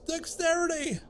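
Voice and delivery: rising excitement. Real, not acted. Gleefully counting stat gains, building.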